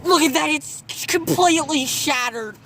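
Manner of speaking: high, loud excited yell; excited shout. Real, not acted. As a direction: shout it out in disbelief. Excited at shattered GoPro.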